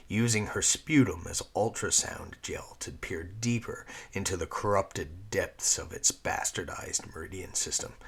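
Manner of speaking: ordinary delivery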